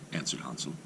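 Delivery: ordinary delivery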